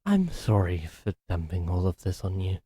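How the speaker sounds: ordinary talk, nothing marked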